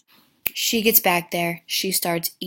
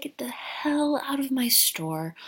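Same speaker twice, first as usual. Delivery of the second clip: mock whisper threat; 5 semitones higher, 5 dB quieter than usual. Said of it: "He's like".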